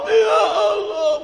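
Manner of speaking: high, slow wail; acted weeping. Pilgrim seeing the Ka'bah, crying out tearfully. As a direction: draw it out as a mock sob.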